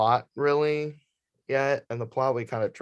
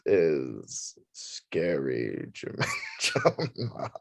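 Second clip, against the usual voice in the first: slow, spooky title announcement; 6 semitones lower, 4 dB quieter, much slower than usual. Acted. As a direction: drag it out like a horror host. Spooky show title intro.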